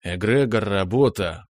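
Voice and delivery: plain reading voice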